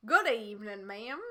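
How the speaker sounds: drawled Texan policeman; thick Texan accent. Acted. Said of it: "drawled in his thick Texan accent".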